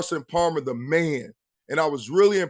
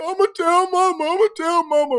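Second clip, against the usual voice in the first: put-on high, whiny child threatening to tell; much higher whiny "I'ma tell mama" repeated; whiny little kid; 11 semitones higher, 3 dB louder than usual. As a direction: whine like a kid running to mama. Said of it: "And I'm like".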